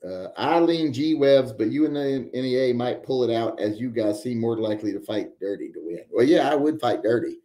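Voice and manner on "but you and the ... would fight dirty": usual speaking voice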